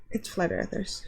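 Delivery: unvoiced mutter; whispered aside. Real, not acted. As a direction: drop to a whisper. Aside about commenters.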